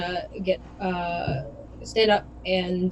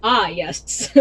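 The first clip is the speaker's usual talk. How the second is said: ordinary delivery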